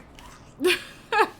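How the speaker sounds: loud laugh breaking into a cough. Real, not acted. Streamer laughing while still choking, loud. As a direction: laugh until it turns into a cough.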